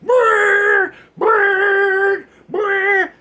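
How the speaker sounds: high, loud engine brrr; loud revving-motor noise. Retold or imitated. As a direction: rev it like a jeep. Imitating the jeep doing donuts.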